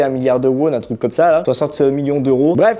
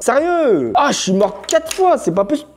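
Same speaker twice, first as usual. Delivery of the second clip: exasperated; 9 semitones higher than usual. Real, not acted. Cookie breaks again.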